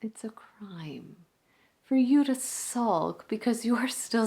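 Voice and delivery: hushed, near-whispered stage monologue with long pauses; opera singer, gently pleading. Acted. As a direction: let the diva soften to a breath, rising briefly to urge the young fan.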